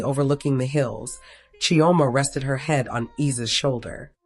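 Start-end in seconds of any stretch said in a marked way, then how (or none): none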